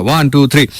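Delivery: ordinary talk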